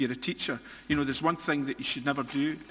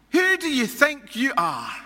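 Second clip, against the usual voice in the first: loud, high, indignant demand; a hammed-up outraged elder; indignant challenge; 9 semitones higher, 6 dB louder than usual. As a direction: challenge him like an outraged official. Leaders challenging Jesus angrily.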